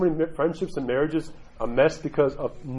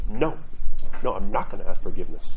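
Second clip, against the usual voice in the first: put-on childish refusal; 7 semitones lower, 4 dB louder than usual. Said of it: "little kid party rises up and goes".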